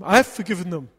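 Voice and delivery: loud, insistent "I've forgiven them"; defensive teen. Retold or imitated. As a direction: snap back, stung and defensive. Daughter defensive in car argument.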